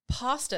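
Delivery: "Pasta" as PAH-stuh; "Pasta", first vowel as in father; posh pasta. Shown as a demonstration. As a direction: say "Pasta" with an open ah. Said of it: "is like being poshy and stupid".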